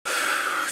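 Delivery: long soft exhale; resigned sigh. Acted. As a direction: let out a heavy breath before bad news. Dreads what comes for Lucy.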